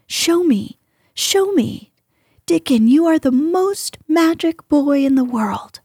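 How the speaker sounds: lightly acted boyish wonder; eager excitement. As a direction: plead with mounting excitement. Colin eagerly begs Dickon to show him.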